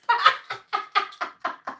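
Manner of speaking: silent wheezing laugh; airy helpless laughter; breathy laugh. Real, not acted. Laughing at her story.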